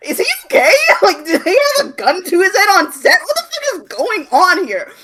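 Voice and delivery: high, loud, laughing outburst; incredulous laughing rant. Real, not acted. Laughing friend rants about a dazed actor, loudly. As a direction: shout it out through laughter, can't believe it. Ranting in disbelief.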